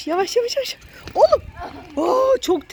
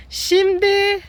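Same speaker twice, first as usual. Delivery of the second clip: ordinary talk, nothing marked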